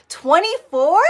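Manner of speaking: high, loud rising question of disbelief; surprised. Real, not acted. Reacting to viewer's guess at her age, surprised. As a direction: shoot it up high and loud, incredulous.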